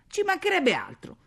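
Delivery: high, loud exasperated refusal; indignant shout. A narrator's reading. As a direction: shout down the very idea. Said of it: "gridò lei". (grido indignato)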